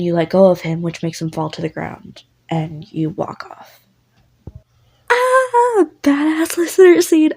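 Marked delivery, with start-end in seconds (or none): 5.1-7.3 much higher, loud gleeful shriek; excited squeal. Real, not acted. Narrator squeals to viewers about badass scene.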